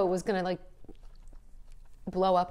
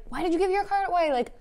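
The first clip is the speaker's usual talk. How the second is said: put-on exasperated boyfriend; 9 semitones higher, 3 dB louder than usual. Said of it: "Max was like".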